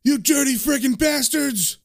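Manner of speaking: high-pitched outraged acted shout; enraged cry with contained volume; furious yell. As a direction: yell it in rage, pitch right up. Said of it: "he yells".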